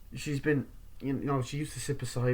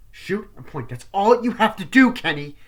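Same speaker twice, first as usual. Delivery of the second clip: much higher and louder, exasperated yell; 9 semitones higher, 10 dB louder than usual. Retold or imitated. Reporter yelling at cameraman Kenny, exasperated high yell. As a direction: snap at him like he's useless.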